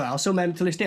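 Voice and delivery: ordinary talk, nothing marked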